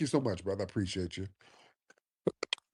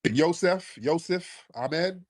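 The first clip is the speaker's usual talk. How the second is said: calling out; 9 semitones higher, much slower than usual. Real, not acted. Host calling the next caller.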